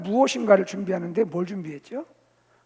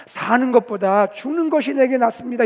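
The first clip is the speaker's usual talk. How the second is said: raised, louder protest; angry plea for death; 4 semitones higher, 8 dB louder than usual. Acted. Preacher as prophet asking God for death, angrily. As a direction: insist loudly, pitch lifted. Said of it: "하나님께 죽기를 구하면서".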